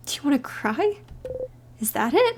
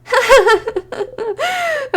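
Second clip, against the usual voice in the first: high giggle; 10 semitones higher, 6 dB louder than usual. Acted. Laugh after failing to cry.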